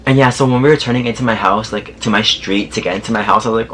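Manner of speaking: normal talking voice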